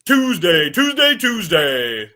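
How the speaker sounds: booming announcer, drawn out; monster truck ad. Shown as a demonstration. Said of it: "monster truck commercial reader guy".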